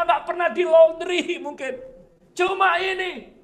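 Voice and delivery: high, loud protest to God; shouted complaint. Acted. Elisa complaining to God about the cloak, high shouted protest. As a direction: plead and complain at the top of your voice. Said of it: "Elisa teriak".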